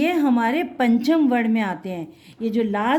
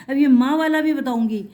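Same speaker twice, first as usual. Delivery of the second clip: usual speaking voice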